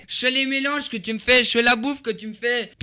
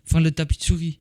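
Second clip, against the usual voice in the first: ordinary talk, nothing marked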